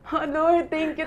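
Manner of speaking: grateful prayer of relief; joyful thanks. Retold or imitated. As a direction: cry out your thanks to God. Thanking God for the eggs.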